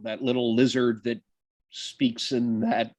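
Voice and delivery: ordinary talk, nothing marked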